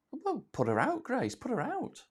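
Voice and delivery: high, teasing joke; playful. Real, not acted. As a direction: toss off a cheeky quip. Joking about friend on fire.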